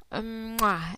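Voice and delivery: quick smacking kiss sound; blown kiss. Acted. Sending a kiss to her listener. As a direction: blow him a kiss.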